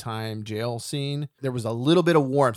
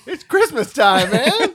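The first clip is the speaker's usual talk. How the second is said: laughing protest; 10 dB louder, slower than usual. Real, not acted. Laughing refusal.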